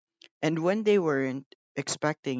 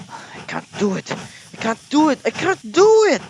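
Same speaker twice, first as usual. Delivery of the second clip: rising strained puffing, building much louder; 12 semitones higher, 10 dB louder than usual. A narrator's reading. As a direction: strain harder each time up the hill. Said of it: "puffed Gordon".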